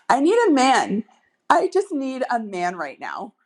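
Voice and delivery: big, playful come-on; flirty demand. Shown as a demonstration. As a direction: declare your need boldly, as a flirt. Demo of flirty line, loud.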